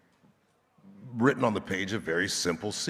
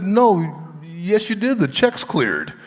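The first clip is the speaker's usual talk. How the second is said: insisting; 10 semitones higher, 8 dB louder than usual. Retold or imitated. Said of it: "I said".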